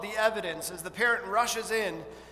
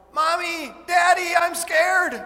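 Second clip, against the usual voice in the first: much higher, loud scared shout; child crying out in fear; 10 semitones higher, 10 dB louder, slower than usual. Pretend. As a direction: call for your parents, terrified. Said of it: "they cry out".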